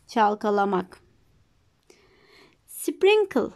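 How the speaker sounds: ordinary talk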